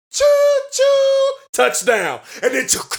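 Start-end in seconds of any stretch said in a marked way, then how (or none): 0.1-1.3 high whistled choo choo; train whistle. Pretend. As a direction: toot it like a steam whistle. Train whistle after chugging.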